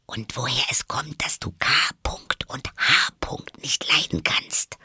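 hushed, mostly whispered question; quiet addition. A narrator's reading. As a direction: whisper it cautiously. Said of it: "fügte sie leise hinzu".

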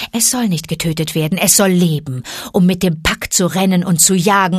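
loud defiant wolf mother; fierce she-wolf. Acted. Mother Wolf defying Shere Khan, fierce and loud. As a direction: stand up to the tiger, fierce and loud.

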